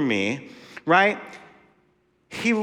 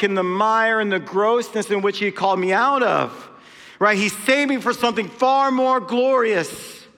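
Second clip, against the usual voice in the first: ordinary delivery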